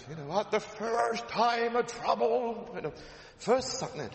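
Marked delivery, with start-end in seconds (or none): 0.4-2.7 slow booming Northern Irish preacher, high; Northern Irish accent. Retold or imitated. Preacher mimics Paisley thundering at his congregation, Northern Irish accent. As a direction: Ian Paisley's Ulster thunder, drawn out. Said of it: "I'm so tempted to do an Ian Paisley accent".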